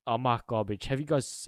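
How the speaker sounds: ordinary delivery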